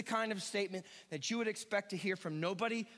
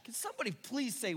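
ordinary talk

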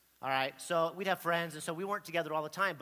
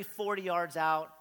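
normal talking voice

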